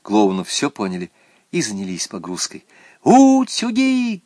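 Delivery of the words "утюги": high, loud drawn-out cry; sing-out yell, pitched way up; girl's excited shout. A narrator's reading. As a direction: shout it like an eager schoolgirl. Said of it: "кричала Наташа".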